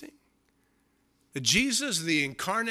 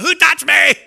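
much higher, much louder mock yell with a laugh; stranger yelling; 12 semitones higher, 17 dB louder than usual. Stranger in football crowd, comic high yell. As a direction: yell it like a startled fan. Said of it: "somebody goes".

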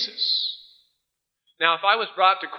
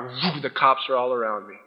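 ordinary talk

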